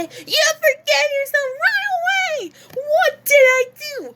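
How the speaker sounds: very high, crying screech; hysterical wailing. Acted. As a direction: wail for forgiveness, hammed up. Acted character wailing for forgiveness.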